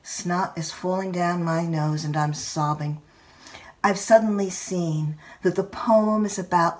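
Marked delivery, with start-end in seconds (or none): none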